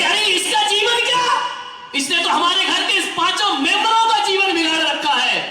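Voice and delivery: high, shouting mother-in-law rant; mother-in-law scolding. Retold or imitated. (ऊँची आवाज़ में चिल्लाती सास)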